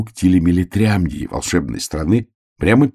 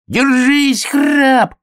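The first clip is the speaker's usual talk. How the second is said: very high drawn-out shout; distant call; 4 dB louder, much slower than usual. A narrator's reading. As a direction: call out high, stretching the words. Shout through the storm to bear cub. (протяжный крик издалека)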